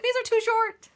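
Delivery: high-pitched mock protest; mock reader complaint. Author imitating readers who find books short, mock high-pitched complaint.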